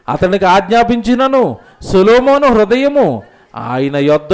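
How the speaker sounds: loud, slow solemn Bible reading; preacher booming out the verse, drawing out the last words; scripture reading. Acted. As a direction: proclaim the verse slowly from the pulpit. Reads scripture aloud.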